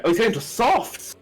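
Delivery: high, surprised commentator exclamation; real surprise. Real, not acted. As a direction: pitch leaps up in surprise. Commentator sees unexpected tyre choice.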